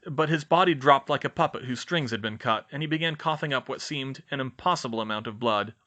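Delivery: ordinary delivery, nothing marked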